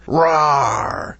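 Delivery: growly little rawr; playful monster growl. Pretend. Reading a comment with roar.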